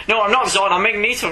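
loud, high offended protest; insist, offended and pompous; indignant villain. Retold or imitated. Magneto insists in retold exchange.

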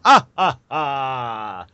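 hearty imitated Jamaican chuckle; Jamaican laugh. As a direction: belt the ad's Jamaican laugh. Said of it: "would go".

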